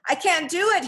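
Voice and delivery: high, distressed admission; high-voiced frustration; strained exclamation. A narrator's reading. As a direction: cry out in helpless effort. Failing to pull her out.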